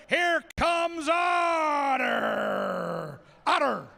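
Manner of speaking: slow drawn-out name call; announcer hyping the crowd for each corgi; ring announcer intro. Acted. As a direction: stretch the name like a boxing intro.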